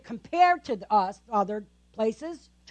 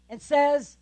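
normal talking voice

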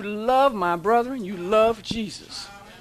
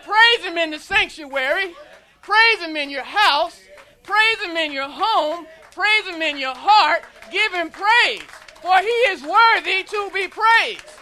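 loud, rising preacher's cadence; preaching at full force; 5 semitones higher, 5 dB louder than usual. Real, not acted. Preacher urging the congregation to praise. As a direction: drive each praise line home.

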